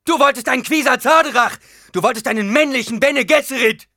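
high, furious, bitter yelling; full-force enraged shout; screaming. A narrator's reading. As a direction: keep screaming accusations at her. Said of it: "schrie er".

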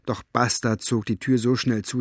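usual speaking voice

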